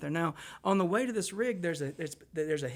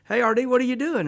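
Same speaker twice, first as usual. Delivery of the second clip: higher and louder, a bright breezy greeting on the phone; caller's cheery hello; 8 semitones higher, 10 dB louder than usual. Retold or imitated. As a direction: play the late-night caller, way too chipper. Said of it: "he calls me up and he goes".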